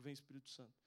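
low, soft repeated invocation; retold prayer. Man praying over a screaming boy in the street, soft low prayer. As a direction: re-enact praying softly over someone. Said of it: "começou a orar junto comigo".

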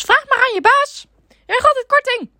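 put-on high, loud bossy old lady. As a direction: demand it like a stubborn caller. Caller lady voiced in story.

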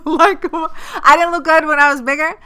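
high, loud, through a laugh; amused outrage at the hidden insult; laughing disbelief. Real, not acted.

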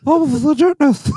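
very high cartoon dude yelling; frat bro. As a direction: squeal it like a stoked toy. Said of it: "how do you think that this dude would sound".

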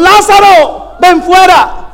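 a ringing high call into the tomb. Acted. Jesus calling Lazarus out of the tomb, shouted. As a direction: summon him out with a shout.

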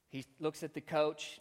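ordinary delivery

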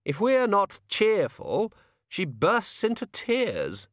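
high, slow academic voice; high, emphatic donnish explanation; Chair of studies. Acted. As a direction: draw it out in a high-pitched character voice. Chair voiced as contrasting wizard.

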